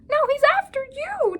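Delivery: very high, loud chirpy trill; trilling bird. A narrator's reading. Bird teasing the cat, high trill. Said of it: "trilled the little bird".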